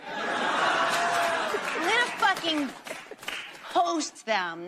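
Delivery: high, drawn-out teasing threat; mock threat. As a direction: stretch it out like a playful threat. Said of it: "teasing threat".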